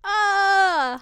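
high shriek of laughter. Real, not acted. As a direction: burst into a squealing laugh.

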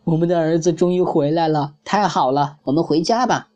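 lightly voiced glad parents hugging their son; happy. A narrator's reading. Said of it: "高兴地说".

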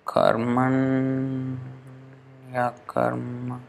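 very soft, slow, drawn-out "karmanya karma"; as one word. Shown as a demonstration. Teacher sounding out the joined form, slow and soft. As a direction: stretch "karmanya karma" out softly, syllable by syllable. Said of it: "as if it's one word".

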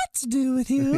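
high slow cracked singsong; high singsong jingle. Pretend.

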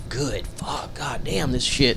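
hushed swearing to oneself; whispered cursing. Retold or imitated. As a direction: whisper it slowly, letting out weary relief. Retold private relief as customer leaves.

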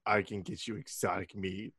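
low, soft, deadpan salesman; Dwight impression. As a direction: murmur it like a shady dealer. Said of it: "he's like".

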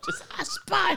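very high laughing echo. Real, not acted. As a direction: squeak it out through laughter. Friends echo the joke laughing.

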